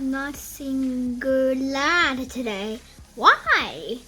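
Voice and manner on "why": ordinary delivery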